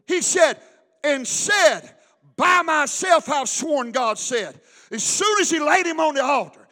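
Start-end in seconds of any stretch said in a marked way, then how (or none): 0.1-3.9 loud slow preacher cry; fiery sermon delivery; preaching. Acted. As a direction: thunder it out from the pulpit. Preaching at full force.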